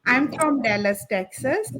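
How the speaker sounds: ordinary talk, nothing marked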